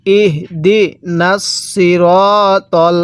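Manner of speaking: drawn-out recitation, each syllable stretched; measured tajweed reading, slow and even; slow Quran recitation. Shown as a demonstration. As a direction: recite slowly so the students can follow. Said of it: "আমার সাথে আপনিও পড়বেন".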